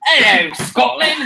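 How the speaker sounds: a quick high Scottish accent attempt. Asked to do Scottish, a light mock Scottish accent. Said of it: "do a Scottish accent".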